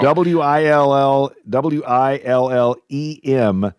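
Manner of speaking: letter by letter, slowly; spelled out slowly. Shown as a demonstration. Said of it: "spelling out the name".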